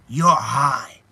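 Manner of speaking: loud, emphatic imitated accusation; mock accusation. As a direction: punch it out as an imitated TV confrontation. Retold accusation from TV scene.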